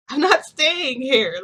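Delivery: loud, slow and high, spelling it out; firm refusal. Retold or imitated. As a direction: plant your feet and refuse, drawn out. Refusing to stay over.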